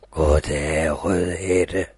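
deep booming big bad wolf. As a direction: growl it out low like a big wolf. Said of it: "sagde den".

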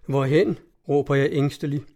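usual speaking voice

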